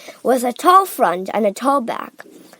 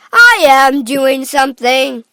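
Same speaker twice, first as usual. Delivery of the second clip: loud, indignant comeback; exasperated retort; 3 semitones higher, 10 dB louder than usual. A narrator's reading. Husband answering his screaming wife, loudly exasperated. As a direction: hit the start hard, exasperated.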